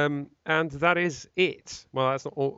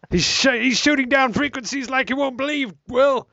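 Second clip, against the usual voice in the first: much higher and louder, panicked boss yelling; frantic phone shout; 12 semitones higher, 5 dB louder than usual. Retold or imitated. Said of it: "They shouted through the, uh, phone".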